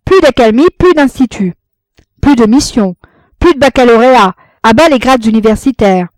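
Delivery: loud, high chanted demands; rally the crowd with each plus; shouted slogans. A narrator's reading. Crowd of subversive motions. (slogans scandés)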